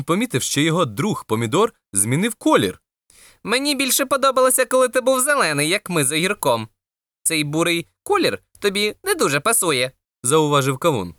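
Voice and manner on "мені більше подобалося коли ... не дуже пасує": higher-pitched watermelon character; a higher voice for the talking watermelon; lightly voiced watermelon. Watermelon commenting on tomato's new colour, lightly voiced.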